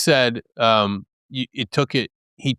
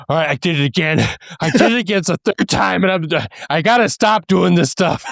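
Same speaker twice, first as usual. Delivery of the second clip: higher, louder, rushed self-scolding; exasperated with himself; 8 semitones higher, 6 dB louder than usual. Retold or imitated. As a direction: blurt it out, fed up with yourself.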